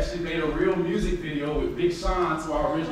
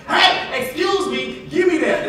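higher and louder, a blunt demand; rude demand; 11 semitones higher, 6 dB louder, slower than usual. Shown as a demonstration. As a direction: snap out a rude order. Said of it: "That's how they talk".

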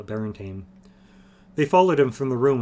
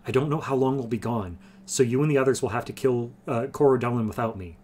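usual speaking voice

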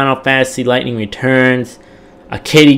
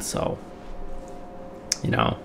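usual speaking voice

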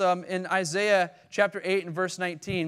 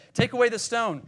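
commanding call; 6 semitones higher, 5 dB louder than usual. Acted. Jesus commands stone removed.

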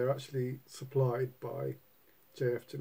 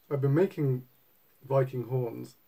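normal talking voice

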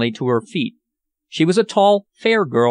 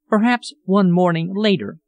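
mildly vague, higher-pitched reply; a little vague; 5 semitones higher than usual. A narrator's reading. Hostess puts off an invitation to ride, a little vaguely. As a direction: put her off a little vaguely. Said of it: "she replied a little vaguely".

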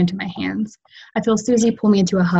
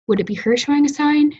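curious question; 8 semitones higher than usual. Acted. Susie asks, looking around.

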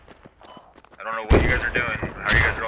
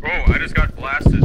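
loud exclamation; 5 semitones higher, 9 dB louder, slower than usual. Real, not acted. Just got shot.

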